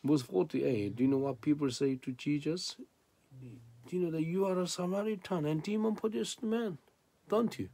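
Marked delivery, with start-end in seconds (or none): 3.9-7.7 quick, higher-pitched imitation of accusers; mock accusation. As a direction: imitate a crowd throwing an accusation. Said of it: "They speak like that".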